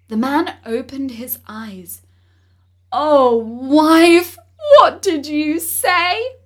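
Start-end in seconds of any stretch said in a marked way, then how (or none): 2.9-6.4 aghast. Acted. Husband shocked by wife's wish.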